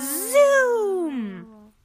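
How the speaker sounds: loud high "Zoom!"; rocket zoom. Acted. Narrator voicing the rocket taking off, loud. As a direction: whoosh the rocket skyward.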